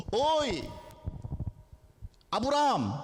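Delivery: hailing from on high, spaced out; calling out. Acted. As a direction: call someone over by name. Angel calls out to Abraham.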